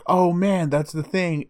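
excited realization. Retold or imitated. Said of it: "And he's just like".

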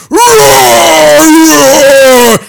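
high, loud hammed-up roar; mock stage roar. The drunk woman mimics Buddy on stage, loud roar. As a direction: roar like a rock singer. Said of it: "He's like, 'Roar".

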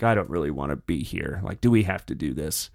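low, soft, put-upon grumble; reluctant grumble. Retold or imitated. Trump sulking about sitting down for the interview, low grumble. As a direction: grumble it like you'd rather leave. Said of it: "a stern and pissed off look".

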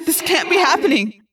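loud, high cry of disbelief; anguished cry. A narrator's reading. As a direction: cry out in desperate denial. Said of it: "I cried".

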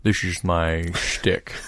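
slow, put-on priest voice; slow comic impression of a priest; Father Hellstrom. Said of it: "Do it in your Father Hellstrom voice".

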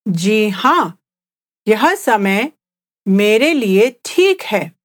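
Hindi reply broken into short phrases. Shown as a demonstration. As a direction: answer slowly, pausing between chunks. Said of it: "let's just speak at a slower pace".

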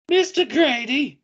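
high, slow British call; British accent. Acted. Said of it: "No, we go British".

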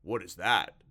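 loud, perplexed creature voice; baffled monster, loud; slimy monster, baffled. A narrator's reading. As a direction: puzzle loudly over a strange word.

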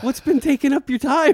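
high, loud mock demand; mock exasperation. Host grilling co-host on his time, mock exasperated. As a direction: pitch the question way up, pretending outrage.

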